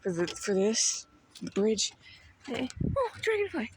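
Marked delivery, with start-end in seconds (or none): 3.0-3.7 excited. Real, not acted. Spotting a dragonfly.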